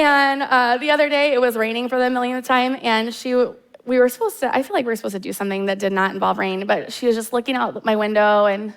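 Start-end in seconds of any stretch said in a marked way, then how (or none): none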